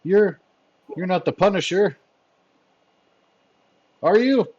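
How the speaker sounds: hesitate, then ask in rising alarm; fearful henchman. Acted. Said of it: "tremble in fear a little bit".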